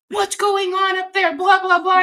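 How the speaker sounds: high, panicky cop voice, mock alarm; freaked-out cop. Cop at the car yelling up the road, high mock panic. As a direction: squeak it out like a rattled cop.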